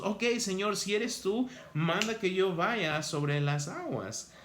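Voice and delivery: lightly voiced bold Peter; a hint of eager Peter. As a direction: play Peter daring to ask.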